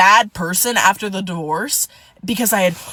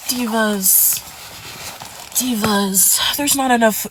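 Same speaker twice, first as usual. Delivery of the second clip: weary sigh on each "divas", with pauses; drawn-out, exasperated teacher's reproach; disappointed choir director; slower than usual. Acted. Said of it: "disappointed choir director sighs".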